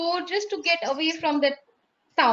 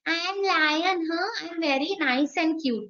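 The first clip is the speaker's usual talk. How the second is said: a soft, sweet lion voice pitched a little higher; cute friendly lion; 4 semitones higher, 3 dB quieter than usual. Pretend. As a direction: play a harmless, cuddly lion. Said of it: "lion doesn't talk".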